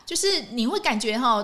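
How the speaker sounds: ordinary talk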